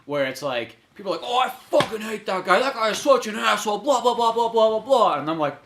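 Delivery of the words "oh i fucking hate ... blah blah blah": higher-pitched mock complainer; mock griping. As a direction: parody people griping, running into blahs. Mimicking people who grumble about someone.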